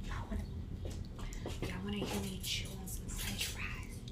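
ASMR-style whisper; breathy whispered tease. Real, not acted. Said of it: "A S M R?".

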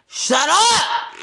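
sudden high, loud yell; angry yell. Real, not acted. Silencing someone mid-rant, yelling angrily. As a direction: burst out with it, pitch jumping up.

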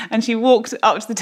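ordinary talk, nothing marked

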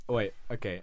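ordinary delivery

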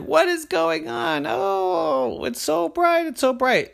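put-on high, loud girl's voice, slowing; dazzled girl. Crystal calls out in the blinding glare, high and loud. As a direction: squint into the glare, high and alarmed. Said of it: "And she said".

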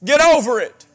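loud, high, hitting over hard; forceful preaching repeat. Real, not acted. Preacher repeating his command to the congregation, loud and forceful. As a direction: drive it home a second time.

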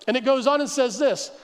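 ordinary delivery

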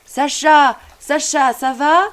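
father calling from another room, loud. A narrator's reading. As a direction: shout his son's name from the kitchen. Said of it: "qui criait de la cuisine".

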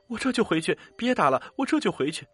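high, pained cry while dodging blows. A narrator's reading. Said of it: "他一边躲一边大叫".

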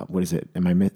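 ordinary talk, nothing marked

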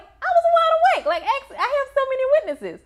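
very high, loud, fast outraged denial with a laugh; indignant protest. Retold or imitated. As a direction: squeal your innocence in mock outrage. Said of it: "I was like".

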